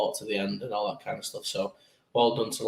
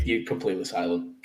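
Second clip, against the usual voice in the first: ordinary talk, nothing marked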